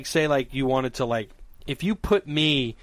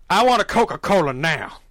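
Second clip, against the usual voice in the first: louder mock demand, pushing each word; retold insistence, raised voice; insistent demand; 3 semitones higher, 5 dB louder than usual. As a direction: demand it firmly, playing it up. Acted insistence to the server.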